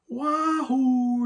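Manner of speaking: high, drawn-out cowboy holler; rodeo whoop. Retold or imitated. Major Kong riding the bomb down like a rodeo, imitated high whoop. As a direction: imitate a long "wahoo" from a rodeo rider. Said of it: "waving his hand like he's in a rodeo".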